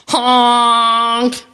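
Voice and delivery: long, held horn honk; drawn-out horn blare; yelled truck honk. A narrator's reading. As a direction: honk like a big dump truck. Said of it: "yelled a dump truck".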